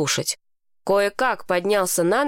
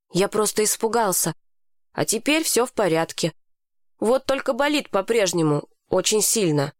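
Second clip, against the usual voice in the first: ordinary delivery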